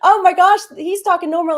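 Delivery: high, loud imitation of an astonished husband; mock astonishment, voice jumping up; amazed retelling. Quoting husband's amazed reaction.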